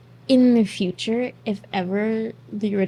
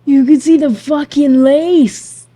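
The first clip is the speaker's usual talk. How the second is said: much louder, slow, higher yell; outraged shout; 6 semitones higher, 14 dB louder, slower than usual. Real, not acted. Shouting at the screen about the wig lace, much louder.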